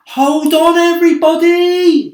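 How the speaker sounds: high, loud, urgent call. A narrator's reading. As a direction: shout a warning to everyone. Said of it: "James called out".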